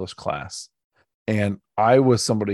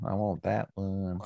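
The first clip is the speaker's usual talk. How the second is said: slow, softer drawn-out order; imitated Charlotte customer; 7 dB quieter, slower than usual. Charlotte diner pointing at the dim sum cart, slow, soft mock local voice. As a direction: quietly drag out the order like a local. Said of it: "imagine a lot of folks in Charlotte are saying".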